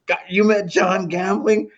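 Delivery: mock amazed coworkers. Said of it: "they're all laughing".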